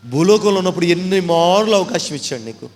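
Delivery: loud rebuking sermon voice; forceful preaching. Acted. Preacher rebuking sinners, loud and forceful. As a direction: thunder it at the congregation.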